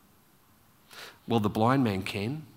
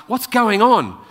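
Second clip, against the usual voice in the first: higher, louder urgent question; raised-voice query from the blind man; urgent asking; 8 semitones higher, 8 dB louder than usual. Retold or imitated. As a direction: call it out over the crowd. Blind man asks about commotion.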